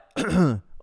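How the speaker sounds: short attention-seeking "ahem"; imitated throat clearing. Shy kid approaching another child, imitated throat clearing. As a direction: clear the throat to get noticed. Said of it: "I think I was like".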